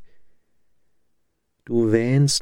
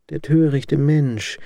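pitying smile; 6 semitones higher than usual. A narrator's reading. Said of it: "rief sie mit mitleidigem Lächeln".

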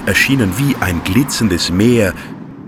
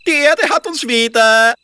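cheeky high little fellow. Acted. Wurstel character voice.

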